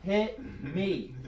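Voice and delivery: hushed demand. Retold or imitated. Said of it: "She goes".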